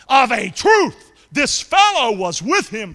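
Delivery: loud, very slow accusation; accusing voice. Acted. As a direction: lean on every word to expose him. Acted accuser in story.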